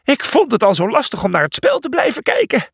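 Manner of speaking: high, flustered character voice; high-pitched, winded whine; puffing. A narrator's reading. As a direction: puff out a complaint. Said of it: "pufte Felix".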